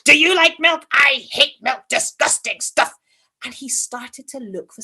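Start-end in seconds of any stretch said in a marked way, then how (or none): 0.0-2.9 put-on loud, complaining old fellow; grumpy old man. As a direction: grumble loudly like a cranky old man. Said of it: "a little old man".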